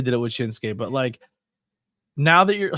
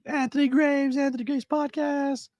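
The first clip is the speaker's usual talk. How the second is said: much higher, announcer-style name call; imitating Mick's hype intro. Host retelling a chat guest's intro, high hype call. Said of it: "He's like".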